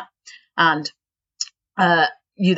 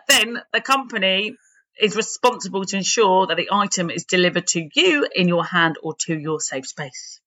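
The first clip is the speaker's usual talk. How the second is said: ordinary delivery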